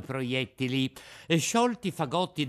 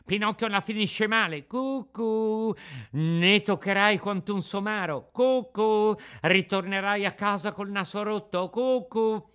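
high sing-song taunting chant, louder; jeering boys; 7 semitones higher, 6 dB louder than usual. A narrator's reading. Street boys mocking Pinocchio, high sing-song jeer. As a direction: sneer it like a pack of street kids. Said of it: "gridò il più ardito di quei monelli".

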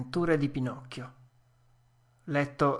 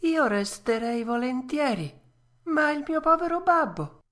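Pinocchio, moved; 11 semitones higher than usual. A narrator's reading. Puppet thanking the fairy, high and tender. Pinocchio worries about his father.

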